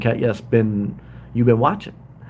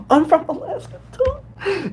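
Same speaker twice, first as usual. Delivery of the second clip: high, gasping squeal of a delighted fan; squealing viewer voice; slower than usual. Pretend. Livestreamer voicing a new viewer's comment, high and gasping. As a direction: voice the viewer like an excited girl.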